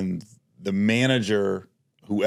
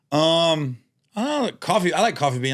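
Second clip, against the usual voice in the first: unsure reply; 7 semitones higher than usual. Retold or imitated. Retelling own hesitant coffee answer.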